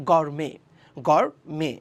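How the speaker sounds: গরমে said with a silent final t, ending on the e; correct pronunciation. Shown as a demonstration. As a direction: slowly model গরমে with no t at the end. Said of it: "এটা উচ্চারণটা হচ্ছে".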